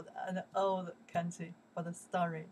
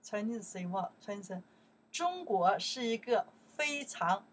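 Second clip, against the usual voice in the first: normal talking voice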